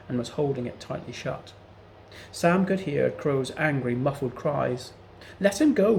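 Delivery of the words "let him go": high, loud pleading cry; desperate plea. A narrator's reading. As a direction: beg for the bird's life. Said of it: "pleaded Sam in desperation".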